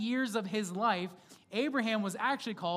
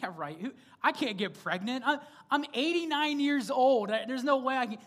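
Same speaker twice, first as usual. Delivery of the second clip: mock Sarah scoffing; 5 semitones higher, 4 dB louder than usual. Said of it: "Sarah laughs under her breath".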